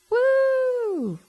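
high-pitched cheer of delight; a sudden, very high woo; excited whoop. Real, not acted. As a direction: whoop it up like the word thrills you. Cheering the word of the week.

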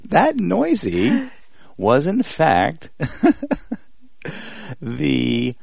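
high, very slow, drawn-out announcement; dramatic reveal. Acted. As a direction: stretch every word like a big reveal.

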